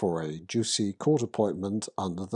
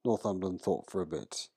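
plain reading voice

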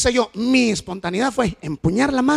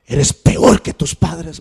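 loud, high rebuke, then slow; daughter scolding; 4 semitones higher, 8 dB louder, slower than usual. Retold or imitated. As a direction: scold him like the hurt daughter. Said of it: "Y me dijo".

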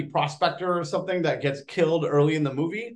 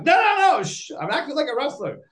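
mock hushing protest; 11 semitones higher than usual. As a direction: shush her in a high voice. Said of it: "I'm like".

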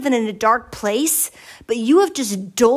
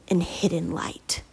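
whisper; 5 semitones lower, 7 dB quieter, slower than usual. Acted. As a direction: whisper it, slow and breathy. Trails into whisper.

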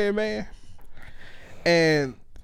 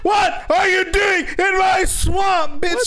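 mock ogre shout; 14 semitones higher, much slower than usual. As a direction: drag it out and bellow it. Shouting swamp line at intruder.

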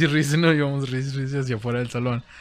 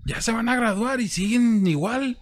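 high exasperated teacher; 11 semitones higher, slower than usual. Retold or imitated. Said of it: "Cómo lo dijo".

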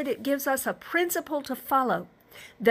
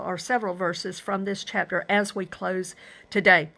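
ordinary delivery

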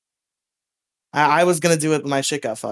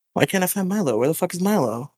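ordinary delivery

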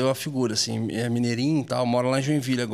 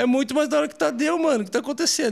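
excited; 10 semitones higher than usual. Retold or imitated. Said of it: "Ele falou".

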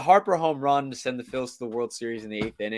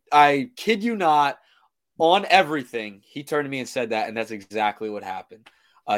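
normal talking voice

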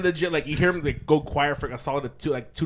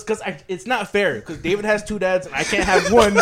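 high, loud imitated protest; mock upset complaint; 5 semitones higher, 6 dB louder than usual. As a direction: imitate a wronged kid protesting, close to laughing. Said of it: "Why are you so upset right now?".

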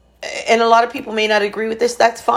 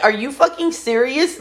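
outraged disbelief; 7 semitones higher than usual. Retold or imitated. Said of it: "I was just like".

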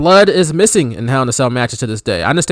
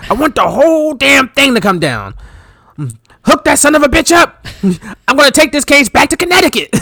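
hammy stage actor; 10 semitones higher than usual. Retold or imitated. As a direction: yell it like a hammy showman. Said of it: "he's just yelling it".

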